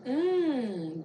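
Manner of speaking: usual speaking voice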